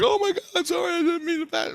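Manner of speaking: very high-pitched imitation of an apologetic woman; mimicked frantic apology. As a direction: play her flustered apology way up high. Retold spirit apologising.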